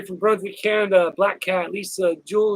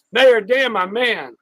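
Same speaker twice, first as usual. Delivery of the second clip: loud, high call of the name; big excited greeting; hyped shout-out; 5 semitones higher, 6 dB louder, much slower than usual. Real, not acted. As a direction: call him out like a hype man.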